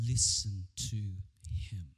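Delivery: very slow, low and firm; deep, deliberate command from above; solemn divine command. Acted. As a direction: space out every word as a weighty decree. Voice of God commands.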